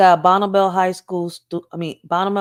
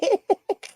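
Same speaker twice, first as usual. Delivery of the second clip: trailing high giggle; high laugh fading; 14 semitones higher than usual. Real, not acted. Streamer still laughing at the clip, fading laugh. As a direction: let the laugh run out.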